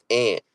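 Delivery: "aunt" said like "ant"; short, front a in "aunt", as in "cat"; ant pronunciation. Shown as a demonstration. Reading out a Jersey viewer's version, "aunt" said as "ant". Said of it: "Tiff from Jersey".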